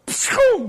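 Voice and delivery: high, punchy "pshoo" whoosh; whooshing noise. Retold or imitated. Preacher mimics prophet fleeing the room, high whoosh. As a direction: imitate someone shooting out of a room. Imitates man jetting out of room.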